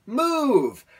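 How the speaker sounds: loud, high, drawn-out yell of MOVE; re-enacted exasperated shout; retold yell. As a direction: yell it at a stubborn bird. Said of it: "I yell again".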